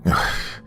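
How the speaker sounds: short, breathy sigh of exasperation; exasperated sigh. Acted. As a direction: let out a fed-up sigh. Exasperated inner reaction to shopkeeper.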